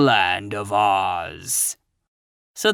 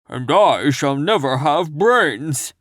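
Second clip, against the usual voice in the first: slow, louder, odd Scarecrow lament; 5 dB louder, slower than usual. A narrator's reading. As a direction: drag it out like a floppy Scarecrow. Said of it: "added the Scarecrow".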